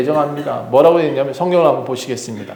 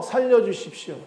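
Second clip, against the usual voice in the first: high but softer, sinking plea; desperate plea; 6 semitones higher, 7 dB quieter than usual. Acted. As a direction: let the cry drop into begging. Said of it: "소리쳤습니다". (잦아드는 애원)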